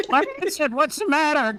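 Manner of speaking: very high demanding squeal; shrill. Acted. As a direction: screech the question up high. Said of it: "Carly Beth demanded shrilly".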